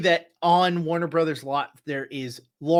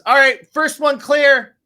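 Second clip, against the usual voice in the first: loud, high-pitched shout to the gate crew; guard calling clear; 12 semitones higher, 11 dB louder than usual. Acted. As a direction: call out the all-clear like a checkpoint guard. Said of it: "and then he goes".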